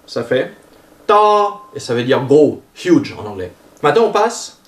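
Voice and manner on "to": "ta" as "to" with Paul's open vowel, pitched high; open O example. Shown as a demonstration. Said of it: "Ça, c'est pour le O sans rien". (« ta » avec un O ouvert, tenu haut)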